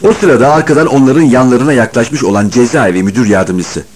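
plain reading voice

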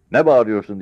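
loud, high scolding; old soldier standing up to an officer; defiant old veteran. Acted. (yüksek sesle çıkışan yaşlı çavuş)

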